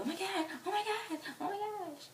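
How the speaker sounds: high repeated squeals; high-pitched giddy chant of disbelief; fangirl squealing. Retold or imitated. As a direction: squeal it over and over, starstruck. Acted fans rubbing the touched hand.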